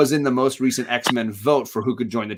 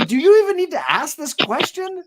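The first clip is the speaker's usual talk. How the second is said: far higher, louder incredulous retort; mock exasperation; 5 dB louder than usual. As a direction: scoff at the silly question. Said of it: "and they're like".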